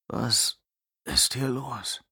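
lallend mit schwerer Zunge; barfly. Acted. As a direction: wie betrunken, schwerfällig fragen. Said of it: "fragte er mit schwerer Zunge". (verwaschen lallend)

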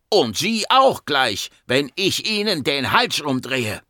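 high, drawn-out furious retort; angry threat. Acted. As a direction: snap back with a threat, stretching the words. Patient threatens to strangle doctor. (wütend drohend)

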